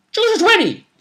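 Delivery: high, loud burst on the number; emphatic exclamation. Acted. Game master champions a simple target number, high loud exclamation. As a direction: call it out high and punchy.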